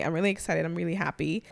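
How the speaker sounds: ordinary talk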